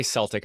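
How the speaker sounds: "Celtic" as SELL-tick; soft-C Celtic. Shown as a demonstration. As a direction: start "Celtic" with an S sound. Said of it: "they do say".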